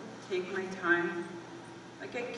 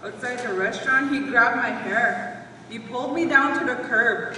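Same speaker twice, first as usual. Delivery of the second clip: louder, forceful retelling of an attack; distressed outburst; 3 semitones higher, 7 dB louder than usual. Acted. Wife recalls being dragged outside the restaurant, forceful and loud. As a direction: let the account burst out louder, hitting hard.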